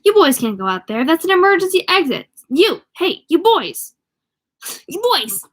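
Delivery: a fussy clerk losing control of the situation; exclaiming clerk. Acted. Clerk shouting after the boys heading for the exit, flustered and loud. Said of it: "Mister Peepers exclaimed".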